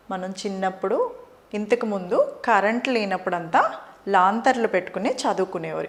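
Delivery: ordinary talk, nothing marked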